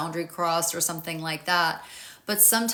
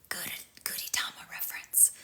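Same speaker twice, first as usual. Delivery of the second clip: very soft, breath-only whisper; quick whispered footnote to a joke; whispered aside; 10 dB quieter than usual. Real, not acted. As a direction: slip it in under your breath. Muttered aside about her joke.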